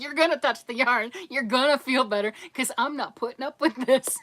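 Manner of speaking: loud, laughing mock order; mock stern husband. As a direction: boss her like the firm husband. Quoting husband's firm order.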